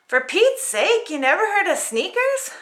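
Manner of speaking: incredulous complaint; exasperated princess. Acted. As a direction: scoff at the glass slippers. Paulina voice.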